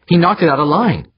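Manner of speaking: high, loud whining blame. A narrator's reading. Woman blaming the worker to crewman, whining. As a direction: complain loudly, pushing blame away. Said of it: "the woman whined".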